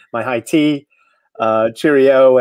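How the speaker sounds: slow, drawn-out mock British cheerio; British accent. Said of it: "and I now have a new accent".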